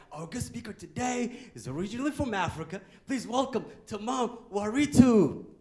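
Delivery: announcer intro. Retold or imitated. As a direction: introduce the guest like a stage host. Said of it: "they introduce me as".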